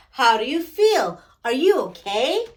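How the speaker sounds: usual speaking voice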